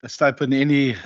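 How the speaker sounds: ordinary talk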